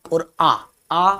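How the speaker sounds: आ drawn out clearly as a long open a; vowel demo. Shown as a demonstration. Teacher demonstrates आ to students, clearly. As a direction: sound out आ slowly for the class. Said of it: "अगर आपने बोला आ".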